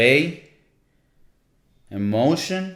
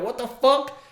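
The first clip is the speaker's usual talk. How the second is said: much higher, startled mock cry; mock shock; 11 semitones higher, slower than usual. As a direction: play the locals stunned at seeing snow. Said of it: "they were like".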